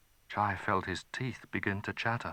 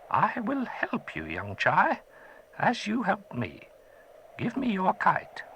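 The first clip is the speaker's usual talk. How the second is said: higher, put-on old man voice; a gentle old wizard, curious; old magician; 12 semitones higher than usual. Old man thanking the boy who freed him, gentle.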